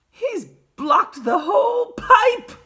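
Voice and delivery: put-on very high, slow old man; piping grandpa in amazement; Grandpa Joe. A narrator's reading. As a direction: cry it out loudly, word by word. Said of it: "said Grandpa Joe".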